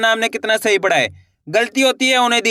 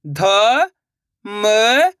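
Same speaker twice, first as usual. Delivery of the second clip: each syllable said apart and rising; teacher sounding out ध and म. Shown as a demonstration. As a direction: sound out ध and म slowly, one at a time. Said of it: "एक बार फिर से देखिए".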